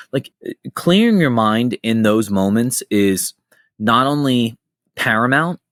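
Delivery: ordinary talk, nothing marked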